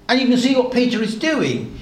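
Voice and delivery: ordinary talk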